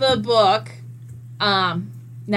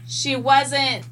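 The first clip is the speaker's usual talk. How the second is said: ordinary talk, nothing marked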